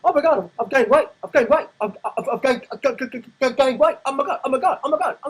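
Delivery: high, loud hysterical wailing; shrill mocking freak-out over the scale; mock panic. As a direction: squeal it like a panicking dieter. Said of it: "they weigh themselves and go".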